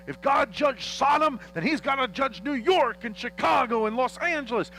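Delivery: mock preacher. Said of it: "but when they say".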